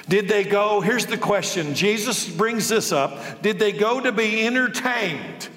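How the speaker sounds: fiery sermon cadence; full-force preaching. Acted. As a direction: preach it hard to the congregation.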